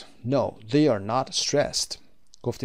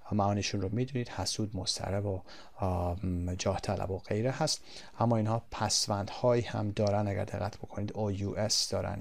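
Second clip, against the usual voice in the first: ordinary talk, nothing marked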